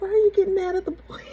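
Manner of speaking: high, fast retold protest; re-enacted exasperated question, rushed and squeaking upward; incredulous protest. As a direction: act out objecting in high-pitched disbelief. Acting out her protest to husband.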